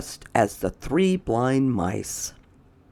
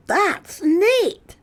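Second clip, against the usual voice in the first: very high put-on voice; Chester's voice; 4 dB louder than usual. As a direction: lift the voice way up for the character. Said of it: "said Chester".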